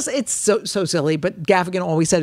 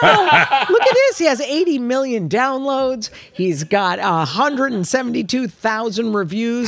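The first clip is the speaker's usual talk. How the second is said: mock amazed; 6 semitones higher than usual. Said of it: "And I thought".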